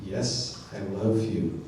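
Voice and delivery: low, soft grudging answer; reluctant. Acted. Sleepyhead in bed answering that voice, mock reluctant. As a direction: give in quietly, pitched well down. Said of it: "reluctantly you respond".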